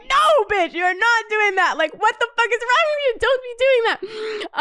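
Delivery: high-pitched retold protest; worked-up mock reprimand; exasperated telling-off. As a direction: scold a friend in shrieky disbelief. Retold scolding of friend, second time.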